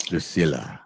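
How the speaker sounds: ordinary talk, nothing marked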